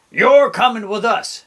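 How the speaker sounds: storybook pirate order; pirate captain order. A narrator's reading. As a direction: tell him firmly like a captain. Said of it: "Braid Beard told me".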